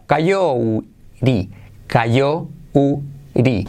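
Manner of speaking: coyote broken up, "kayó - u - ri"; syllable-by-syllable pronunciation. Shown as a demonstration. Teacher building it from "cayó", coyote as "ka-YO-u-ri". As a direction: spell out coyote by sound, drawn out with gaps. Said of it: "Como cayó".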